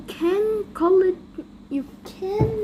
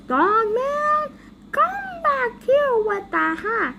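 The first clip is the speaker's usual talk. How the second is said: calling after someone; 7 semitones higher than usual. Acted. Boss calling Dogman back with the hat, yelling. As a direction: holler it high after a runaway.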